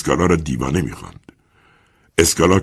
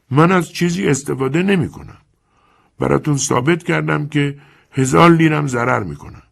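put-on a calm, sly old lender talking down to the young man; old moneylender Chiarenza; 11 semitones higher than usual. Chiarenza denies.

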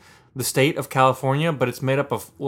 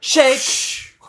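shouted shake sound; 12 semitones higher, 4 dB louder than usual. Pretend. Mock handshake sound effect.

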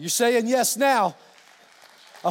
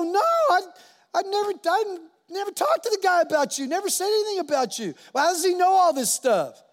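high, hammed-up denial; mock protesting; 8 semitones higher than usual. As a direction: play the accused spouse, pitched way up and insistent. Acted spouse denying they told pastor.

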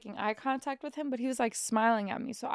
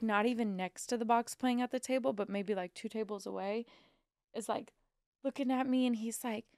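ordinary talk, nothing marked

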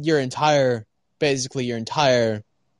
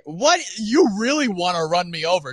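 indignant shout; 5 semitones higher than usual. Retold or imitated. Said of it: "And I was like".

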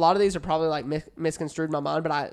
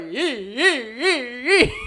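gleeful yee; 7 semitones higher, 4 dB louder, much slower than usual. Retold or imitated. Brother spinning and throwing snake.

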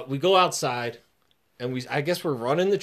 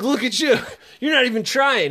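mocking taunt; 10 semitones higher, 4 dB louder than usual. Bully mocking at camp stretches.

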